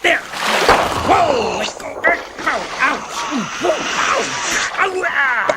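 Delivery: high yelps of pain while being grabbed. Acted. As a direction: cry out as the suckers grab you. Grabbed by suction cups.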